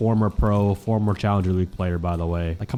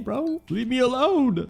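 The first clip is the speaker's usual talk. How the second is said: hyped; slower than usual. Real, not acted. Hyped after a near ace.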